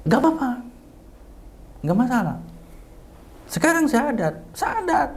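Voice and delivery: very high, insistent urging. Retold or imitated. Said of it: "Suara agak tinggi sambil nangis saya". (mendesak dengan suara tinggi sambil menangis)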